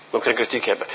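"geen" pressed, the whole line dropped low and soft. Shown as a demonstration. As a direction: quietly press "geen" home the second time.

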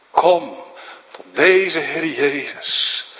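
ordinary delivery

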